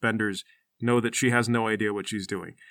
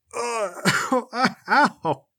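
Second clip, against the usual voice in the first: high, whimpering hurt noises; pained groans; 8 semitones higher than usual. Acted. Bingle knocked over by a hit, acted pained groans. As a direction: groan and yelp after a tumble. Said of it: "he stumbles backwards and kinda rolls over".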